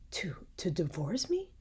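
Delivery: incredulous, swooping rise on the question; shocked cry. A narrator's reading. Mother and daughter learn of the divorce, shocked cry. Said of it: "cried Hippolyta and Matilda both at once".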